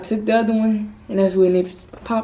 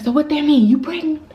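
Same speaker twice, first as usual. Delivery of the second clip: a touch higher, louder mother asking; mom's sharp question; 7 semitones higher, 3 dB louder, faster than usual. Retold or imitated. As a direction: mimic mom asking, a bit raised. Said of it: "She's like".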